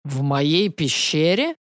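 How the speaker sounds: slow, rumbling dragon in disbelief; thundering dragon voice. A narrator's reading. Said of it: "грозовых громыханий голосе дракона".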